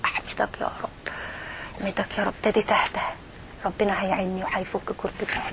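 very soft, low, near-whispered thanks; hushed private prayer. Acted. Worshipper thanks God privately after seeking help, hushed and relieved. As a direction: murmur it inwardly, relief settling in.

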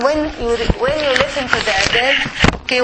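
ordinary talk

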